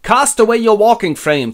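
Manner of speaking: high, loud incantation-like command; Gandalf. Acted. Wizard rouses old king, high commanding shout. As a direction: order him out of his frailty. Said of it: "Gandalf goes".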